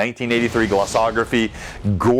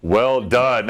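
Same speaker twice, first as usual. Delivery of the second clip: ordinary delivery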